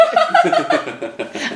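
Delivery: high squealing laugh; real high-pitched laugh at the memory; high burst of laughter. Real, not acted. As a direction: break into a shrieking giggle. Laughing at naked cyclists.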